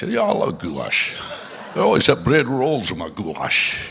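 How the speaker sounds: put-on lightly done Scottish accent as the old actor; playing the cranky Scot at a dinner party; Sean Connery. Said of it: "Sean Connery utter the word".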